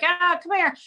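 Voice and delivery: high, loud coaxing call to a horse; coaxing animals. Retold or imitated. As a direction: sweet-talk the horse over. Calling to horses sweetly.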